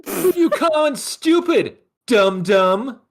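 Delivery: high, slow schoolyard taunt; whiny taunt. Acted. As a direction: drag it out like a playground bully. Mock insult exchange.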